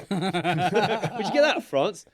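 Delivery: high, loud, slow mocking laugh through the words; laughing mock question. Older skaters mocking the kid's shirt, laughing loudly. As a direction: sneer at the kid while laughing. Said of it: "older skaters were like".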